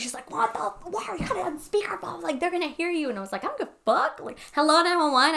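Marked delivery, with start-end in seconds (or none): 0.3-3.1 high-pitched mock fretting; imitated paranoid partner. Host imitates paranoid partner during emergency call, mock alarm. 3.4-5.3 high, louder mock indignation; retold defiance. Host re-enacts calling the cops over partner's protest, defiant.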